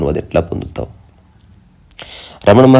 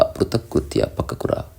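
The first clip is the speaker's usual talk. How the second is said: soft whispered word and meaning; 3 semitones higher, 9 dB quieter than usual. Acted. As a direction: whisper it like a secret.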